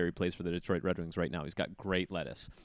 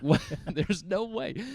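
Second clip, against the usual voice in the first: laughing disbelief; 9 semitones higher, slower than usual. Retold or imitated. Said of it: "I'm like".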